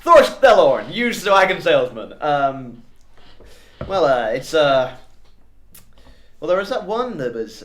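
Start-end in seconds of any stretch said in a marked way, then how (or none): 0.0-2.1 loud, pushy sales pitch voice; car salesman voice. Acted. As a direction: sell it like a used car dealer. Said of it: "going for the car salesman type voice now".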